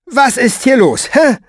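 high, forceful, indignant questioning; bristling challenge; confrontational demand. Acted. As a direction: demand an answer, pitched well up. Intruder confronts Fenoglio, jabbing him.

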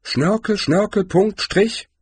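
high, tentative symbol-by-symbol reading; high-pitched baffled questioning; confused reading. A narrator's reading. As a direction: puzzle out each sign in a high voice. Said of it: "fragte sie verwirrt".

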